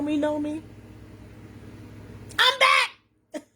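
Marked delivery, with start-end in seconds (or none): 2.4-3.4 high, squealed announcement; excited shout. Real, not acted. Host announcing her return to loyal viewers, excited shout. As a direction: burst out with it in glee.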